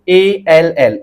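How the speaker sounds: एएलएल drawn out slowly letter by letter; spelled out. Shown as a demonstration. Teacher repeats to students: एएलएल spelled out slowly. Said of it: "लेकिन आपको क्या पढ़ना है".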